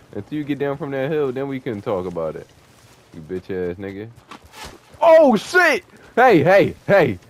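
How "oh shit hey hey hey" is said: high, loud startled yelling; alarmed shout. Real, not acted. Gamer shouts at an enemy who nearly killed him. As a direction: yell it out as a shot barely misses.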